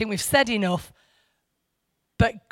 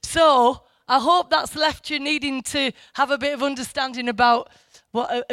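normal talking voice

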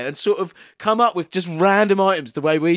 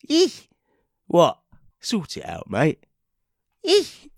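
squeaky mock eek; slower than usual. Squeaky cartoon reaction.

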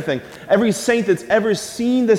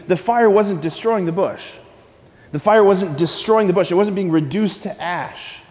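ordinary talk

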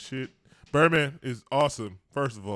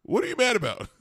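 imitated challenge; 9 semitones higher, 5 dB louder than usual. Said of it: "he's like".

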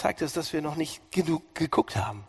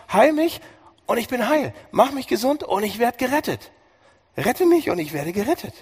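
sehr hoch und laut gerufen; zuversichtlicher Ruf; 9 semitones higher, 6 dB louder than usual. Acted. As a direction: ruf es voller Gewissheit. Prediger spielt Jeremias Gebet. (hoher, lauter, zuversichtlicher Ruf)

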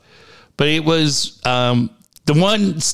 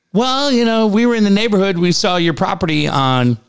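pitched-up stalling phone voice; awkward caller; 8 semitones higher than usual. Retold or imitated. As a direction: mimic a hedging stranger, starting high and sinking. Imitating the awkward caller explaining himself.

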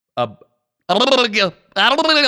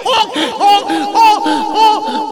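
high looping "oh, oh" drop; imitated high radio sound effect; homemade soundboard effect; 4 dB louder, much slower than usual. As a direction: vocalize a repeating soundboard clip. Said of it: "I have to do the sounds myself".

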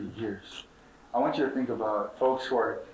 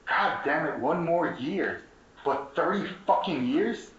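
slow, higher, drawn-out outrage; rising outrage; 5 semitones higher, slower than usual. Real, not acted. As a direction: stretch each word, climbing in disbelief. Outraged build on thirty years.

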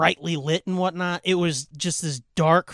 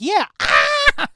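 much higher, louder squeal joining in; mock high scream; 11 semitones higher, 6 dB louder than usual. Teasing the cohost as a scared kid, a shriek. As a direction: shriek along with the girls. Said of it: "you being like".

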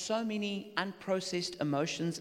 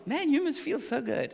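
excited praise; 9 semitones higher, 6 dB louder than usual. Acted. Preacher modeling a listener's joyful reply, excitedly. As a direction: cheer them on with big delight. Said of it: "You've gotta feel with them".

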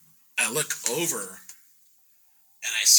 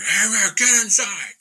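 high, loud imitated yell; retold shout, pitched way up; calling out; 12 semitones higher, 7 dB louder than usual. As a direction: call the kids in from afar. Retold yell calling kids inside.

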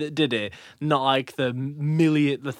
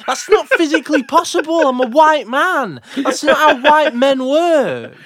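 laughing; 12 semitones higher, 5 dB louder than usual. Real, not acted. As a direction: laugh out the punchline. Laughing at own joke.

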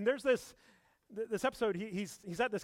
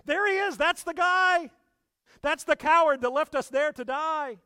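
much higher, loud accusing voice; angry crowd; 10 semitones higher, 9 dB louder than usual. Retold or imitated. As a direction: point him out, outraged. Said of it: "and say".

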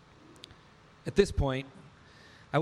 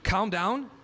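higher and louder, rising in disbelief; incredulous retort; 8 semitones higher, 4 dB louder than usual. Retold or imitated. Father throws calm down back at wife, incredulous. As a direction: throw her words back at her. Retorting in heated marital argument.

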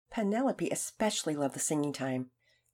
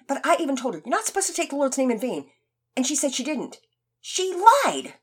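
put-on high, rushed, stammering boy; fast stammer; 6 semitones higher, 4 dB louder than usual. Boy babbles his defense to the teacher, stammering fast. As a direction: trip over the words in a panic. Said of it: "spitting his words out so fast that he began to stammer".

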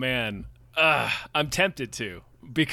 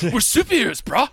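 frat bro; 3 semitones higher than usual. Pretend. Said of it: "that's the bit".

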